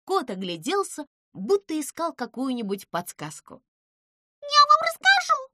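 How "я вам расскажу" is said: rattling. A narrator's reading. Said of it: "затараторила Пимпочка".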